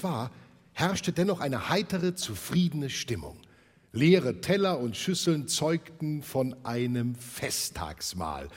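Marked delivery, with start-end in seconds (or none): none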